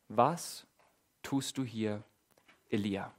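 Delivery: low, soft and hushed; a soft voice asking Elia what he does here; quiet voice of God. Acted. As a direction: ask gently from the stillness. A voice asks Elia.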